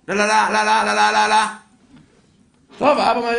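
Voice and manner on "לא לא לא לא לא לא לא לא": loud, high rapid la-la-la on one note; imitated signalling. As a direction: mimic insistent flashing signals. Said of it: "מתחיל לאותת לו עם האורות". (חיקוי איתות טורדני בקול רם)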